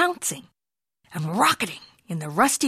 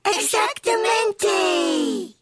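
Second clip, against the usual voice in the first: a much higher, very slow drawn-out howl of the word; 12 semitones higher, much slower than usual. Acted. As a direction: stretch it out like dogs howling. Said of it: "howled the doggies".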